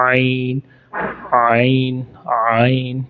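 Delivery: आइन from the throat; throat letter. Shown as a demonstration. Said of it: "ये भी हलक से निकलता है". (आइन हलक से)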